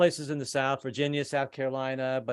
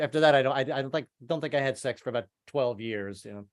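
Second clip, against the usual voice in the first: ordinary talk, nothing marked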